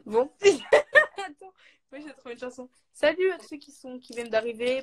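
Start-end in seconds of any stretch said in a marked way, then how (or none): 0.3-1.1 high loud laugh; burst of laughter. Real, not acted. Laughing at the game. 1.2-2.7 laughing. Real, not acted. Laughing at the game.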